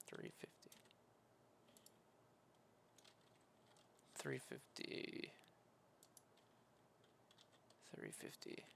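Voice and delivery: soft unvoiced mutter while typing; repeated whispered number; whispering to self. Real, not acted.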